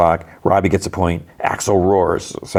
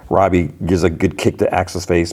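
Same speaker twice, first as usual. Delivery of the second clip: ordinary delivery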